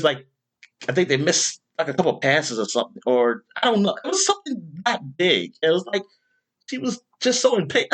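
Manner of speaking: usual speaking voice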